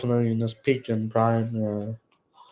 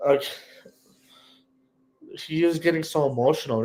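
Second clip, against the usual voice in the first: ordinary talk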